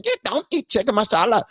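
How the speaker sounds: high put-on Indian accent; mock Indian accent. Host re-enacting the head-bobbing man, mock Indian accent. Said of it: "He was doing the head bob thing".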